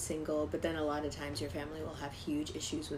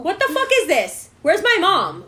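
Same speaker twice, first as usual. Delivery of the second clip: high, loud retold outburst; losing it; 10 semitones higher, 13 dB louder than usual. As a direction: yell in outraged panic. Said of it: "lost my shit".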